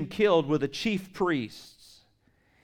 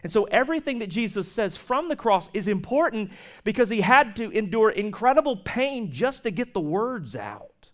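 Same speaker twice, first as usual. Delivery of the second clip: usual speaking voice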